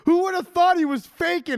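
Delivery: can't believe he was faking, yell it; incredulous outburst. Real, not acted. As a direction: fan shouting at the screen in disbelief, loud. Ranting about the twist.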